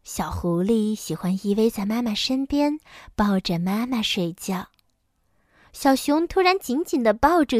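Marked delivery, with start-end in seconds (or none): none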